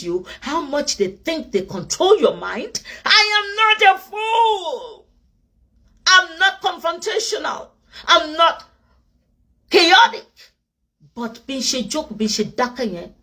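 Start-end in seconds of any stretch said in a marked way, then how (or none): 3.1-10.2 high, slow, forceful declaration; emphatic shout. Real, not acted. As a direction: plant each word loud and high. Defiant declaration to critics.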